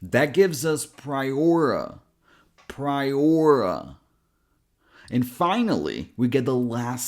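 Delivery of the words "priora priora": PRIORA with a long, punched OR and quick final "uh"; stress on OR. Shown as a demonstration. Teacher building the word, "priora" slowly stressed on OR. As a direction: hit the middle of "priora", then a light schwa. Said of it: "this is the stress syllable".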